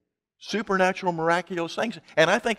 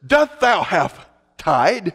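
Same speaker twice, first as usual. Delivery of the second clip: slow, grandly drawn-out archaic question; mock-Elizabethan declaiming, stretched out; Elizabethan preacher; 2 semitones higher, 4 dB louder, much slower than usual. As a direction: imitate a pompous old-English preacher. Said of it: "you see him at the grocery store and he says".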